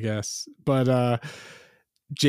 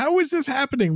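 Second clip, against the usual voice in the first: incredulous; 14 semitones higher, faster than usual. Retold or imitated. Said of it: "and you're like".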